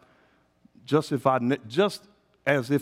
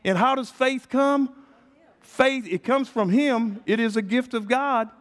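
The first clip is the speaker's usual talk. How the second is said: full-force preaching; 9 semitones higher than usual. Acted. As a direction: rouse the room with it.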